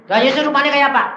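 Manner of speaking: high, loud question; acted-out keen curiosity; eager questioning. Replays quizzing the shaman.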